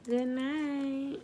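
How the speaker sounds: high, hushed sign-off; soft good night. Real, not acted. Sleepy host signing off to viewers, high and soft. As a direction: say it softly, up high and drawn out.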